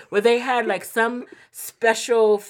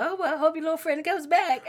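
mock grandma voice; 9 semitones higher, faster than usual. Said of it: "she hit my partner with".

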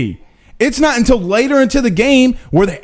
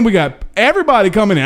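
normal talking voice

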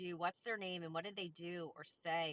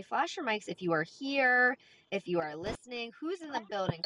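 loud rallying call to the room; 2 semitones higher, 8 dB louder than usual. Real, not acted. Host calling out to audience.

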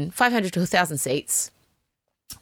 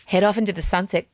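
ordinary talk

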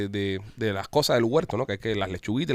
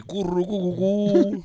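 high mock cooing "curru cu cu cu"; playful pigeon coo imitation; 7 semitones higher, much slower than usual.

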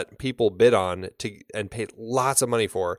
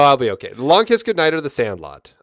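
ordinary talk

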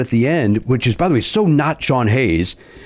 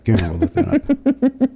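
mock formal voice; 12 semitones higher, slower than usual. Answers being called good sir.

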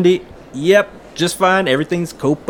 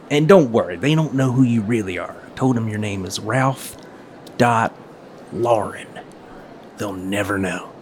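dropping lower and much softer to a near hush; conspiratorial hush; 5 semitones lower, 5 dB quieter than usual. Acted. Carl confiding to Ralph in front of Mindy, hushed and conspiratorial. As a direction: lean in and keep it secret.

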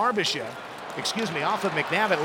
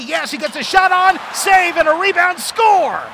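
goal call; 10 semitones higher, 7 dB louder than usual. Real, not acted.

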